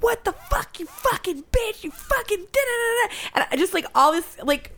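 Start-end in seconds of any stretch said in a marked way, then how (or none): 0.0-3.1 high, harsh mock yell of an angry driver; retelling a road-rage driver's screaming, high mock yell; mock screaming cowboy. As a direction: shriek his insults back at her. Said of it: "he starts screaming at me".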